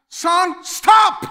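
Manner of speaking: put-on high shouted warning to a running child; urgent yell. Father yelling at son running toward the street, shouted warning. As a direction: bellow it to stop him in his tracks. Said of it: "And you yell out".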